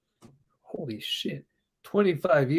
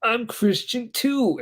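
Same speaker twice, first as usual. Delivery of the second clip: excited; 8 semitones higher, much slower than usual. Retold or imitated. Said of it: "he got all excited".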